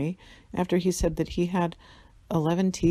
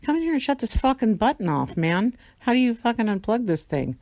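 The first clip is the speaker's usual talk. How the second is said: exasperated rant; 5 semitones higher, 4 dB louder than usual. Retold or imitated. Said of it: "It's like".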